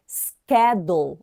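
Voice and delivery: schedule with a hard sk, SKED-jool; American schedule. Shown as a demonstration. Teacher demonstrating American schedule. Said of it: "a gente pronuncia como schedule".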